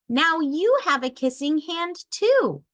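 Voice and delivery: put-on high, rising child voice; a kid proudly sharing. A narrator's reading. Child tells mother.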